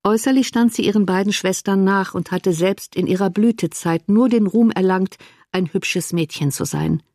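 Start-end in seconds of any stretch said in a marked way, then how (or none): none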